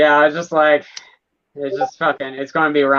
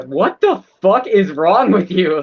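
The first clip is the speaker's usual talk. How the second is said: slower, higher, louder disbelieving demand; exasperated retort; 5 semitones higher, 4 dB louder, slower than usual. Retold or imitated. Imitating her reaction to fake-cheerful people, slow exasperated demand. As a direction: drag out each word in disbelief. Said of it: "I'm like".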